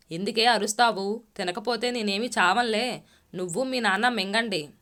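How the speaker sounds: plain reading voice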